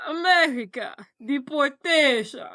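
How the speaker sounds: drawn-out hammed-up Russian accent. She tells William she's being deported, mock Russian accent. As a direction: stretch the words in mock Russian. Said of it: "can you say it in her accent".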